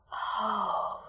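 soft, hushed oh; boy awestruck by the falling rock. A narrator's reading. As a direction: breathe it out in awe. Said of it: "said Peter in awestruck tones".

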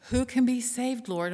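high, loud alarmed plea; disciples' alarmed, pleading question to Jesus; pleading cry. Retold or imitated.

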